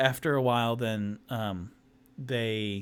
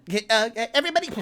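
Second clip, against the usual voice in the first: high squeaky greeting; 12 semitones higher, 6 dB louder, much faster than usual. Acted. Tipsy greeting halflings.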